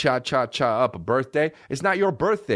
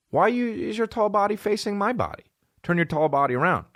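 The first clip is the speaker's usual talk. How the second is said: much higher, silly put-on voice all through; goofy put-on voice; 10 semitones higher than usual. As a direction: bark it like a goofy drill sergeant. Joking command to a friend.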